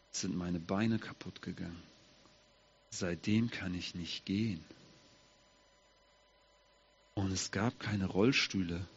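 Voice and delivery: quiet sad admission; sad confession. Acted. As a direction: let the sorrow sink the voice. Mephibosheth tells his lameness.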